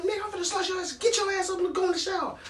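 high-pitched imitation, not shouted; mock a riled man ordering someone about; worked-up threat. Resident ordering the storyteller into the shower, worked up.

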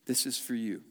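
God speaking as a gentle father, soft. Retold or imitated. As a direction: say it like a kind father. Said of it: "gentle and kind".

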